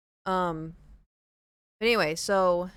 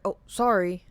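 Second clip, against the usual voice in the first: sheepish stranger; 9 dB louder than usual. Retold or imitated. Stranger caught peeping.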